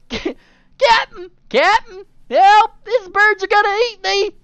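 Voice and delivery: high loud desperate cries; panicked screaming. Acted. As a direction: scream for rescue. Fleeing character screams for help.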